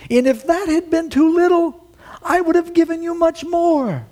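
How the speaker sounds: high, loud proclamation; booming prophet. Retold or imitated. Nathan declaring God's words to David, loud and high. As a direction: declare it as the Lord's promise. Voicing the Lord through Nathan.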